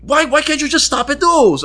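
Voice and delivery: high mock-indignant whine; hammed-up nagging bar-goer; imitated exasperated woman. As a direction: scold him way up in pitch. Imitating her retort.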